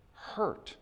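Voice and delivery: breathy whispered hurt; quiet hurt. Real, not acted. Preacher naming the parents' pain in a whisper. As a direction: let the word fade to a whisper. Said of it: "They feel".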